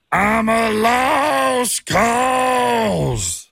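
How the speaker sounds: long, drawn-out high wail, straining the voice; hammed-up, throat-straining country-star howl. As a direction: belt it out as a bad celebrity impression. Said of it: "try to do an impression".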